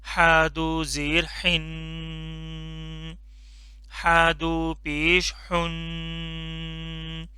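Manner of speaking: very slow, drawn-out drill for repeating; slow drilled recitation. Shown as a demonstration. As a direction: stretch each syllable for the class to echo. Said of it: "सही से पतला करके पढ़ना है". (बहुत धीमे, खींचकर पढ़ाना)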